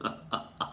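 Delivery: breathy villain snicker; villain's quiet evil laugh; evil chuckle. Pretend. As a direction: gloat under your breath. Villain gloating.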